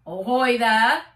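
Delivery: loud booming call across the water; booming hail. A narrator's reading. Policeman hailing the boat, booming shout. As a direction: boom it out like a hail from another boat. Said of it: "A booming voice came blasting".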